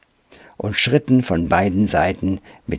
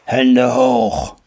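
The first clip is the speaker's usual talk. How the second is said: rough, hoarse shouted order; hoarse call; 3 semitones higher, 3 dB louder than usual. A narrator's reading. Ape ambushes the boy in the cart, hoarse call. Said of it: "eine heisere Stimme rief".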